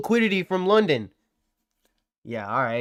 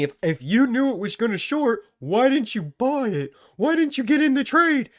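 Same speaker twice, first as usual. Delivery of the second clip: higher, whiny mock question; mocking a viewer; 7 semitones higher than usual. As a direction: parrot the dumb question back. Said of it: "Did you really just ask me".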